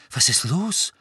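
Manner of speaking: a small startled question. Acted. Girl seeing her friend hit his scar, mildly startled. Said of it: "fragte Hermine erschrocken".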